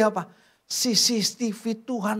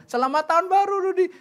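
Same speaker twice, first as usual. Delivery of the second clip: high sing-song mock mother; mother's phone greeting; 10 semitones higher, 5 dB louder than usual. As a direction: chirp it like a fussing mother on the phone. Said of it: "Mamanya telepon sama dia".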